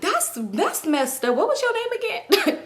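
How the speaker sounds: slow then very high, teasing; mocking disbelief. Girl mocks the friend over her forgotten name, slow then high. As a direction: stretch it then pop up high, half laughing.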